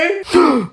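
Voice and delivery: high startled intake of breath; sharp gasp. Acted. Teacher's dream breaks, gasping. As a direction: gasp as if jolted awake.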